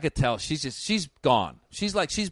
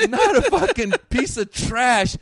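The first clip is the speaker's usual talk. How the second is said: louder and higher, slow and laughing; laughing outburst; 8 semitones higher, 6 dB louder, slower than usual. Real, not acted. Comic telling how a classy lady heckled him, laughing loud. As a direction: blurt it out through laughter.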